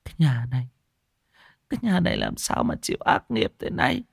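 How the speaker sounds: high, wailing widow's lament. A narrator's reading. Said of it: "mà than". (than khóc ai oán, giọng cao vút)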